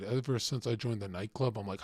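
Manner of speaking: normal talking voice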